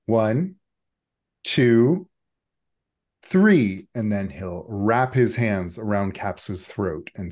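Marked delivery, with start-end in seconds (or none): 0.1-3.9 each number louder and higher than the last; building count. Acted. Necktie counting down at Caps, building to a loud peak. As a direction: wind up the count toward the attack.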